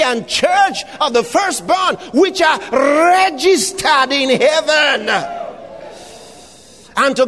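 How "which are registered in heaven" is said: ordinary talk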